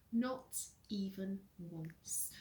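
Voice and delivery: whispered under the breath; hushed aside. A narrator's reading. Narrator whispering to the listener, slow hush.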